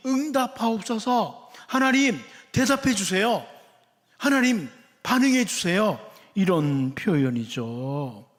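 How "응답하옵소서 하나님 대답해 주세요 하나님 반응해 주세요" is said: higher, insistent prayer; pleading appeal. Acted. Believer begs God to respond, pleading appeal.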